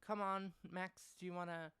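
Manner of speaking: mock-cheery dad offering Max a ride; dad's voice. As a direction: put on the fake-nice father. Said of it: "it was like".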